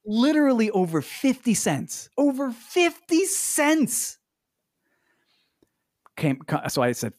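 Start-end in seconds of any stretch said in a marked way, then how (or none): 0.1-4.2 high, drawn-out disbelief over the price; incredulous. Real, not acted. Telling a friend about haggling, high incredulous. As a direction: stretch out fifty cents in outrage.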